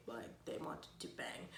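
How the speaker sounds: breathy whisper, half to herself; whispered aside. Real, not acted. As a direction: lean in and whisper the joke.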